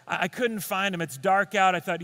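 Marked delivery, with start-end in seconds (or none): none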